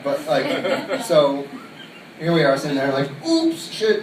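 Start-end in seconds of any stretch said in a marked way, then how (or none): 3.2-4.0 high, startled yelp; a kid caught in the act; mock panic. Kid caught by cops, high startled yelp.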